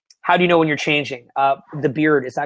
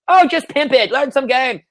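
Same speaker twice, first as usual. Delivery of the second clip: higher, louder mock-casual quote; flippant advice; 7 semitones higher, 4 dB louder, slower than usual. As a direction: toss out glib advice in a raised voice. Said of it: "That's like with game".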